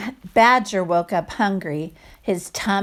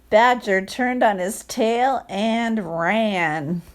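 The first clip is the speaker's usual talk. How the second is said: ordinary delivery, nothing marked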